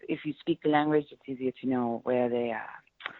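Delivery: English with a French accent. Shown as a demonstration. Performer showing off accents in a French accent. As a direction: demonstrate a French accent, slipping into French. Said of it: "French I can do because I speak".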